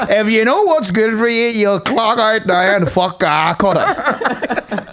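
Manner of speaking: high, forceful telling-off; mock threatening rant. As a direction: imitate a mate laying down the law, drawing out words. Friend's threatening rant on phone, retold.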